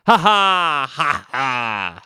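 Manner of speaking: short hammed-up villain chuckle; mock evil laugh. Vampire-looking man laughing at the band, hammed-up chuckle. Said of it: "Our vampire-looking man says".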